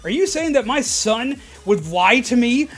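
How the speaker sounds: imitating an irate customer threatening the clerk's job; furious customer. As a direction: scream at the clerk for calling her son a liar. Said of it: "she completely lost it".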